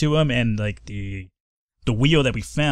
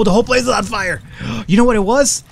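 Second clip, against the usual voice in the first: panicked; 9 semitones higher, 5 dB louder than usual. Real, not acted. Character on fire, panicking.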